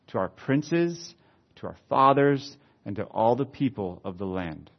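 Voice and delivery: solemn, measured pulpit reading; scripture reading. Acted. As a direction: weigh each phrase of the confession. Reads the prayer aloud.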